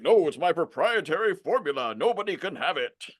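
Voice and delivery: high-pitched mock protest; guard it like a jealous expert, hammed up; exaggerated parody of a secretive coach; possessive guru.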